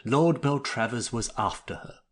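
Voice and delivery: ordinary delivery, nothing marked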